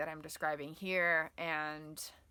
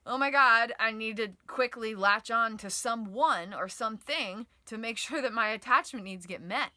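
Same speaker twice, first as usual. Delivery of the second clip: loud, urgent plea to latch on; panicky clinging voice; 4 semitones higher, 9 dB louder than usual. Acted. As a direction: blurt it out like a panicked child. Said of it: "The attached stress response is like".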